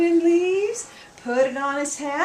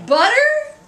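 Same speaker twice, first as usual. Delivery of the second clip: high, loud incredulous "Butter?"; mammy can't believe her ears; shocked cry; 12 semitones higher, 8 dB louder than usual. Acted. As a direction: shriek the word in disbelief.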